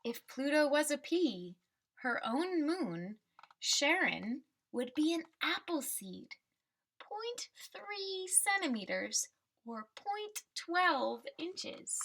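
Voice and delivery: slow, bright storybook read with a lift on Pluto; reading to children. Acted. Teacher reading the class a picture book, storybook voice.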